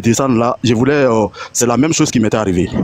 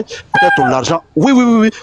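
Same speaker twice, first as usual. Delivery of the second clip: mock cashier; 8 semitones higher than usual. Teller mocking the cashier's excuse, very high voice. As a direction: parody her lame excuse.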